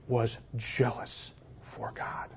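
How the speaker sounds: slow, breathy whisper for emphasis; hushed whisper. Real, not acted. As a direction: drop to a whisper for weight. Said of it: "preacher drops to a whisper".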